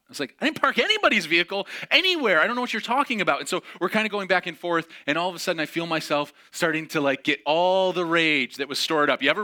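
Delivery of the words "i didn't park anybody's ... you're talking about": loud, high, fast denial; indignant outburst. Retold or imitated. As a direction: protest in rising anger. Angry denial to registrar.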